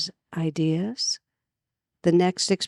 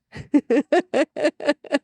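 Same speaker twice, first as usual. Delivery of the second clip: amused laugh; 9 semitones higher, 5 dB louder than usual. Real, not acted. Amused by the story.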